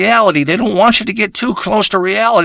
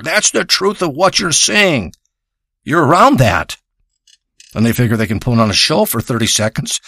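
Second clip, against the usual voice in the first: ordinary delivery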